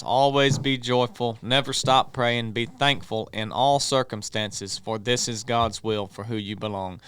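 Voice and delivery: ordinary talk, nothing marked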